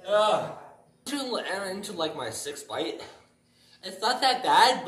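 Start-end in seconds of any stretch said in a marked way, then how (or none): none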